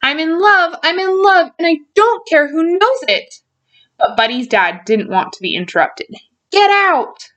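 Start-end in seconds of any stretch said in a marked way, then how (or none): 0.0-3.4 high, giddy lovestruck announcement; excited declaration. Acted. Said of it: "Buddy was so excited". 6.5-7.3 high, sharp outburst; angry dismissal. Acted. Dad rejects the interruption.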